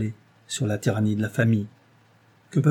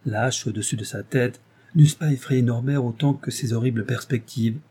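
usual speaking voice

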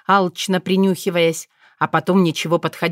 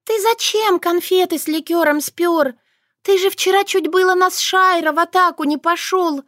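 high, squeaky scolding voice; scolding little mouse; 11 semitones higher than usual. A narrator's reading. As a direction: shame him like a strict little nanny. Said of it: "Шушана стыдила".

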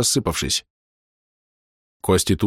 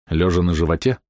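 ordinary delivery, nothing marked

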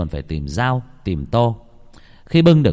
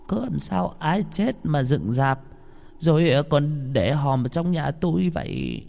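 put-on revived old woman; 6 semitones higher than usual. A narrator's reading. Revived old mother asks her family, slow high quavering question. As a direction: draw it out high and slow, bewildered. Bà cụ sống lại hỏi.

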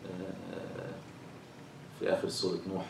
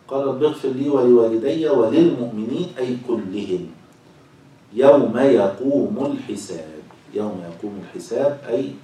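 slow, loud, drawn-out scriptural reading; formal reading aloud; 3 semitones higher, 9 dB louder, slower than usual. Acted. Teacher reads verse and commentary to class, slow formal reading. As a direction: read it out with stretched, emphatic phrases.

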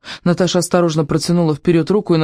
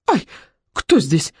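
startled little yelp and question; frightened cry; slower than usual. A narrator's reading. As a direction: cry out, mildly scared. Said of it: "испуганно вскрикнула она".

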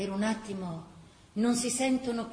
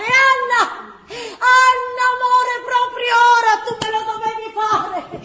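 high, loud, frantic wailing shout; desperate husband yelling; bawling husband; 11 semitones higher, 11 dB louder than usual. Acted. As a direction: bawl at her as labour starts. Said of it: "si mette a berciare".

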